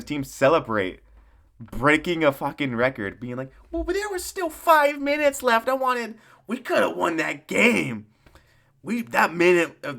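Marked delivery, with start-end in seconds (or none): 3.7-10.0 high put-on complaining voice; lightly mock a coach's excuses; whiny coach. Mocking the head coach griping about the refs, in a high whiny voice.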